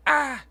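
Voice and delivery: sudden high shriek imitating the baby; baby's shriek. Mom imitating her daughter's tantrum, a high shriek. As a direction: mimic her tantrum scream. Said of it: "She's just like".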